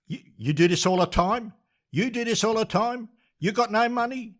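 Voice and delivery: high-pitched incredulous challenge; retold indignant demand. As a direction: act out confronting the fare dodger, pitch climbing. Retold angry confrontation over unpaid fare.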